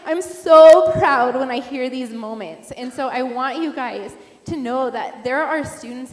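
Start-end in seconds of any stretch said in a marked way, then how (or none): 0.1-2.7 excited. Real, not acted. Proud pastor praising youth.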